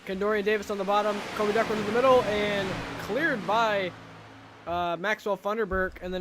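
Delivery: excited play-by-play. Acted. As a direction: call the three-wide battle. Live race call.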